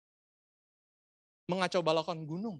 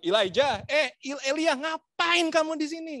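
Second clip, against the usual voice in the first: higher, louder accusing shout; harsh wrong way; 9 semitones higher, 10 dB louder than usual. Shown as a demonstration. Preacher demoing how God did not speak to Elia, loud scold. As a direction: bark it like an angry God. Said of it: "Nggak gitu".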